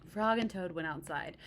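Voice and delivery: ordinary delivery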